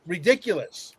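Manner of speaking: ordinary talk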